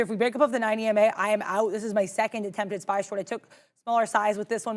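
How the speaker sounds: ordinary talk, nothing marked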